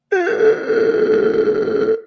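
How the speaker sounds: long, very high wheezing laugh; helpless high-pitched cackle; high laughter. Real, not acted. Host cracking up at the clip, high laughter.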